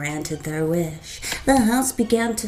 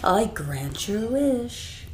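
grand, sly genie voice; 5 dB louder than usual. Acted. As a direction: savour the irony. Genie grants fame.

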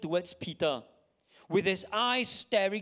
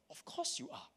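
breathy whisper of a rebuke; whispered reply; 12 dB quieter than usual. Acted. Conscience whispering back at Peter's denial, whispered.

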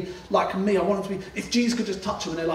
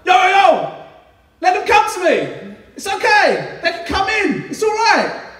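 high, loud friendly shout; high, loud, casual shout across a crowd; calling out; 8 dB louder than usual. Retold or imitated. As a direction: call the kids over from far away. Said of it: "He's like".

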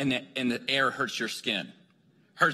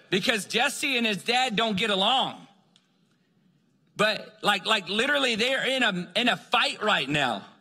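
usual speaking voice

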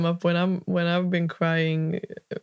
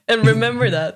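real laugh through the words, louder; amused, pitched up through laughter; 4 semitones higher, 5 dB louder than usual. Real, not acted. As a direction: chime in while laughing. Listener laughing as she chimes in.

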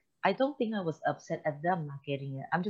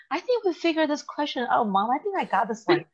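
higher voice for the proud sons; eager kids; 10 semitones higher, 4 dB louder than usual. Retold or imitated. Sons tell mom through the door they solved the question, high and eager. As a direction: play the boys proudly announcing they solved it. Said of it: "They're like".

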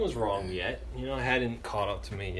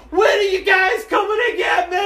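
very high, loud wail; hammed-up desperate holler to departing friends; calling into distance; 10 dB louder than usual. Shouts to vanishing friends.

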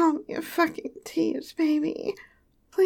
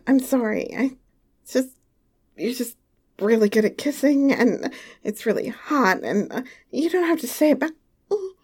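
normal talking voice

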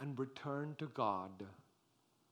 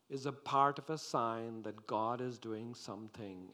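ordinary delivery

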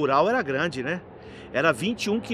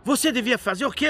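higher-pitched retold lecture; lightly acted telling-off, pitched up; mock reproach; 6 semitones higher than usual. Father correcting the bar owner, reproachful.

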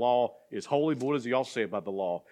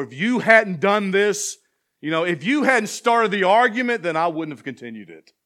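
a hammed-up indignant comeback; defensive excuse; 7 semitones higher, 9 dB louder than usual. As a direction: snap back the excuse at your partner. Acted excuse in an argument.

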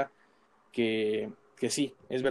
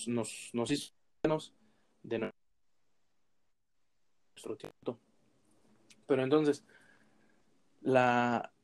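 choppy, breaking-up audio; bad connection. Real, not acted. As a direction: voice dropping out on a bad line. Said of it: "no te escuchas bien".

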